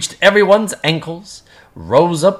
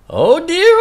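high rising oh dear; worried cry; 12 semitones higher, 4 dB louder than usual. Acted. Gabu at his wits' end, a high rising worried oh dear. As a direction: ask it of yourself, lost. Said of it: "cried Gabu".

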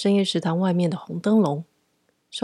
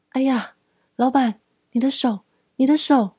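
frightened cry; 6 semitones higher than usual. Acted. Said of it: "惊恐地指着老板的手，一边大叫".

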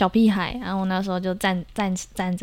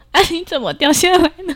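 laughing call; 9 semitones higher, 7 dB louder than usual. Retold or imitated. Calling out to fallen brother.

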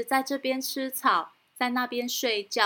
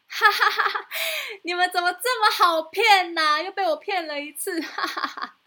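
higher teasing laughing speech; mocking laugh; 8 semitones higher, 4 dB louder than usual. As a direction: gloat over the fooled farmers. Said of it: "又指着他们笑说". (得意嘲笑)

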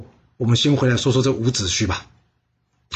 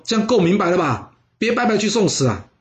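higher-pitched pleading warning; exasperated plea, pitched up; urgent warning; 6 semitones higher than usual. A narrator's reading. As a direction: urge him to stay, lightly acted. Brother urges him not to go.

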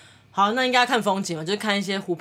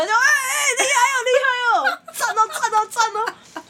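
much higher, fast laughing squeals; mock shrieking cheer; 4 dB louder than usual. Aunties cheering the boat spinning, shrieking mock praise.

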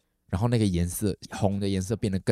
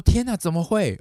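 high, loud disbelieving question; shocked; 10 semitones higher, 5 dB louder than usual. Retold or imitated. Sister on phone hears of blood, shocked. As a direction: react aghast to terrible news.

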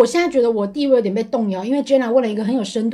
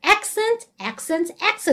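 slow, higher, soft triple repeat; English-drill imitation; 4 semitones higher, 4 dB quieter, much slower than usual. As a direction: copy a language drill word by word. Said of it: "那你现在像那个". (模仿英语教学，慢速连念三遍)

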